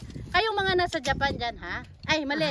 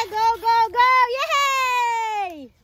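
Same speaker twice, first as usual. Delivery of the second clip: excited cheering; 8 semitones higher, 10 dB louder, much slower than usual. Real, not acted. Cheering self on while sawing.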